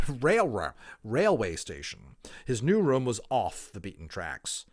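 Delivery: put-on clear, lively reading of a children's book; expressive storybook narration; story narration. A narrator's reading. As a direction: read it aloud like a storyteller to listeners. Reads a children's novel aloud.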